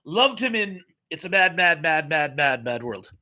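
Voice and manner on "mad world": ordinary talk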